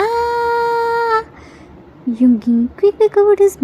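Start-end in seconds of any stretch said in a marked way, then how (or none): 0.1-1.2 put-on a high, strained child's shriek; high childish scream. Jimin screaming his lungs out at everyone, high tantrum shriek. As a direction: let out a shrill-pitched tantrum scream. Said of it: "Jimin shouts his lungs out".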